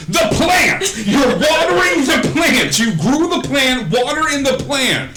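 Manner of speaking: high-pitched, full-out shouting; Bob. Acted. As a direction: holler it high and loud in exasperated disbelief. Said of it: "eventually Bob's like".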